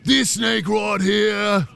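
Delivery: loud, drawn-out wildlife host shout; mock animal expert, high and very slow; Bear Grylls. Host mocking an animal expert, loud drawn-out presenter voice. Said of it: "some sort of Bear Grylls animal expert".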